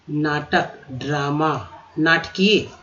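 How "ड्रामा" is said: usual speaking voice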